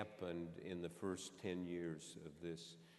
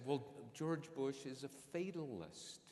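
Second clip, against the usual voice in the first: mildly excited retold quote, pitched up; slow, higher, imitating her getting worked up; quite excited; 5 semitones higher than usual. Retelling Hillary's verdict on Bush, mildly excited. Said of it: "she got quite excited".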